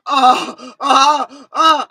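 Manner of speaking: loud, high mock moans; three short, hammed-up moans; imitated moans. Storyteller imitating what blared from dad's speakers, loud moans.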